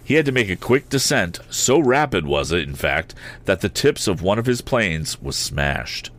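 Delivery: plain reading voice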